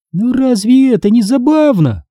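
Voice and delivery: ordinary delivery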